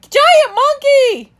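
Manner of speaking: high, loud terrified scream. A narrator's reading. Orange Cat sees the giant ape and screams in terror. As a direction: shriek in panic. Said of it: "screams Orange Cat".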